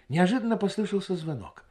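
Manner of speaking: usual speaking voice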